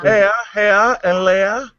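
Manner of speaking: "Hair, hair, and layer" run together like a posh "oh, hello"; posh English accent. Shown as a demonstration. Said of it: "Yeah, there you go".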